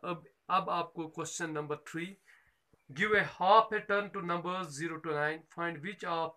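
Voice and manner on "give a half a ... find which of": slow reading. Shown as a demonstration. Teacher reads question three.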